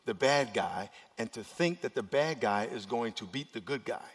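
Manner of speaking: normal talking voice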